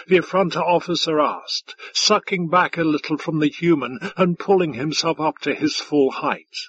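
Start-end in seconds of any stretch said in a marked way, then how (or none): none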